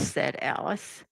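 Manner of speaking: plain reading voice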